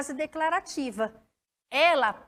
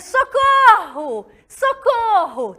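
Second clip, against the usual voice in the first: high, loud, slow call for help; mock cry for help; 8 semitones higher, 8 dB louder, much slower than usual. Shown as a demonstration. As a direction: cry out as if in danger. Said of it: "Agora eu poderia dizer".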